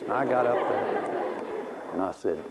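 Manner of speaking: normal talking voice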